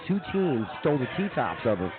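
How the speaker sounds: ordinary talk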